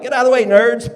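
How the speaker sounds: loud, high mock bully yell; bully genes. His genes shoving her genes aside, loud mock yell. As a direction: shove them aside with a loud high holler. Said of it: "my genes were like".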